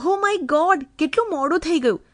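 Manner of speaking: high-pitched, hurried fluster; flustered exclamation. A narrator's reading. Wife sees husband home and runs off, flustered exclamation. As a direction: gasp out that time has flown, pitched high. Wife startled at being late.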